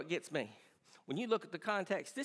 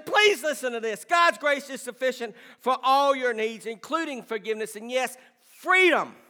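preaching at full force; 9 semitones higher, 12 dB louder than usual. Acted. Pastor insisting grace is sufficient, building preaching shout. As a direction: hammer it home to the church.

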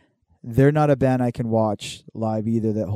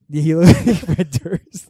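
laughing; 4 semitones higher, 8 dB louder, slower than usual. Real, not acted. Laughing while repeating punchline.

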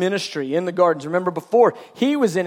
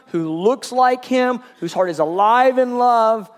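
ordinary delivery

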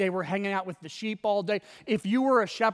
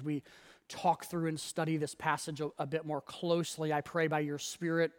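soft, hushed prayer voice; closing the sermon's opening prayer, soft and reverent; 5 semitones lower, 6 dB quieter than usual. Acted. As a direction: pray quietly and humbly over the congregation.

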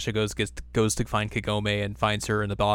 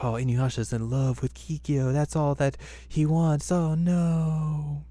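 higher, slow lovelorn moan; mock jealous pining; 5 semitones higher, slower than usual. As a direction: pine over it, stretching the words out. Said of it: "because she was jealous".

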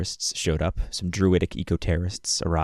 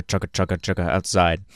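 normal talking voice

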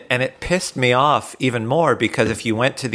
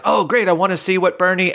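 excited; 8 semitones higher, faster than usual. Retold or imitated. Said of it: "You're like".

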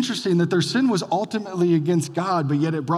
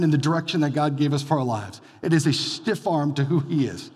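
ordinary talk, nothing marked

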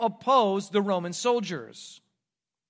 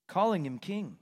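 normal talking voice

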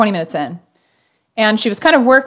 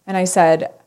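normal talking voice

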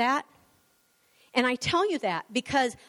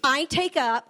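bold, slow, firm proclamation; emphatic declaration prayer; 3 semitones higher than usual. Acted. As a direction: declare each phrase for the church to repeat. Leads declaration prayer aloud.

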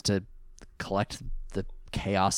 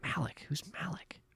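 puzzled whisper; 8 semitones higher, 12 dB quieter than usual. Real, not acted. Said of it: "confused name whisper".

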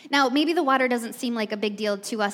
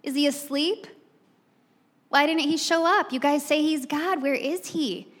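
higher, slow jeering taunt; mocking taunt; 6 semitones higher, slower than usual. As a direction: needle the prophets, higher and drawn out. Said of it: "I'm gonna start taunting you".

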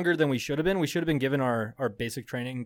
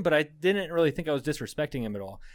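ordinary talk

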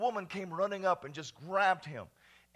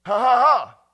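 joyful loud laugh; 4 semitones higher, 18 dB louder than usual. Real, not acted. Joyful exclamation after scripture.

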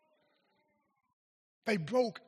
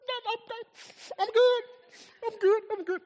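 much higher, insisting I'm good; tearful denial; 5 dB louder, faster than usual. Retold or imitated. Crying preacher telling his pastor he is fine, voice high and strained. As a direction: squeak out a brave I'm good through tears. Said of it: "I said".

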